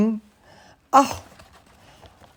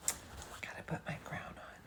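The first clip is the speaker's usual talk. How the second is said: whispering; 28 dB quieter, faster than usual. Real, not acted. Aside before crown.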